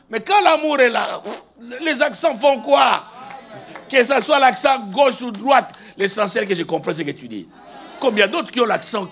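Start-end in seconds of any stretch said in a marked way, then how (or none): 0.1-7.5 impassioned preaching. Real, not acted. Preacher telling the church love outweighs accents, impassioned preaching. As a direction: drive each phrase home loudly to the congregation.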